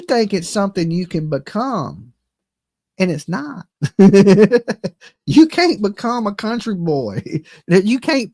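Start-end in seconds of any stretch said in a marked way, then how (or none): 3.7-4.9 loud mocking chuckle; loud laugh. Real, not acted. Laughing at rival wanting to be country, loudly. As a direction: burst out laughing at him.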